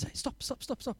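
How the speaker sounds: breathy hushed urgent stop; startled whispered stop. Retold or imitated. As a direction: hiss it out, alarmed. Man startled during prayer.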